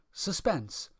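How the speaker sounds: usual speaking voice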